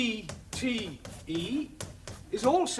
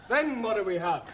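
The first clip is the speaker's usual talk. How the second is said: usual speaking voice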